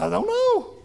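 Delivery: very high, squeaky child imitation; six-year-old boy. Son answering someone who asked what was wrong, squeaky child impersonation. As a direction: mimic a puzzled little boy way up high. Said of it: "Here's a six-year-old boy saying".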